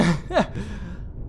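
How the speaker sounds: brief self-amused chuckle; short laugh. Real, not acted. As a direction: break off into a little laugh. Amused at own unkindness to Seagull.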